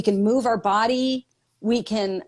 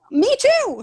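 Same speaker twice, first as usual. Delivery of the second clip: high, bright squeal of recognition; delighted me too; 4 dB louder than usual. Pretend. A reader gushing over a messy photo, squealing. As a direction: squeal it like a reader who finally feels seen.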